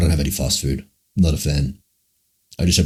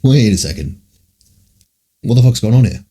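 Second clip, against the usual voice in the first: rising, louder, alarmed; alarmed realization; 6 semitones higher, 5 dB louder than usual. Retold or imitated. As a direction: piece it together, getting alarmed. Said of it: "And then I'm like".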